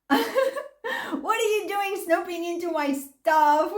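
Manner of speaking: high, slow, laughing through a mock accusation; ex-husband turning it around, mocked through laughter. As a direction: laugh while imitating his outrage.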